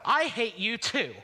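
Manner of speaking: high, slow furious retort; angry shout. Acted. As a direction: hurl it back in anger. Lovers' quarrel, shouting back.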